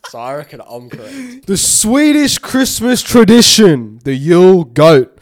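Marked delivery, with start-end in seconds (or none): 1.5-5.2 fact reading. Acted. Reads a fact.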